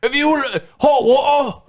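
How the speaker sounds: high, slow put-on British hawker; mock British accent selling from a bucket; hammed-up British water salesman; British street seller accent. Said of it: "practice our British accents".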